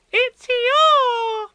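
put-on very high child's squeal; thrilled little Roo shrieking; terribly excited. A narrator's reading. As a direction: cry it out, stretching the last word. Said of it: "terribly excited".

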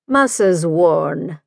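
slow Scottish brogue, stretching every sound of Missus Warne; lingering Scottish accent on Missus Warne. A narrator's reading. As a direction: draw out Missus Warne into a long Scottish hum. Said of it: "his Scottish brogue extending the sounds".

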